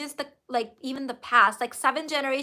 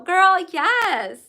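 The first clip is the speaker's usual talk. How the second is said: louder, higher "yes" of delight; excited cheer; 5 semitones higher, 6 dB louder than usual. Real, not acted. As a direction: cheer her on. Cheering a viewer's comment.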